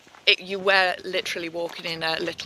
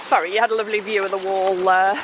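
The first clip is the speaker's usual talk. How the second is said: ordinary delivery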